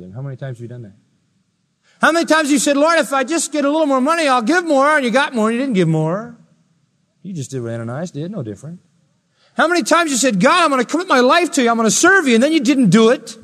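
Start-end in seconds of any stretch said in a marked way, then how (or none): 2.0-6.4 loud, high, pressing accusation; full-force preaching. Real, not acted. Preacher challenging his congregation over broken promises, full-force preaching. 9.5-13.4 loud, high, pressing accusation; full-force preaching. Real, not acted. Preacher challenging his congregation over broken promises, full-force preaching.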